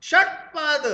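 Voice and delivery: षटपद with a hard retroflex ष, loud and stretched; loud slow demo word. Shown as a demonstration. Teacher showing students the retroflex sound in षटपद, loud. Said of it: "अब बोला".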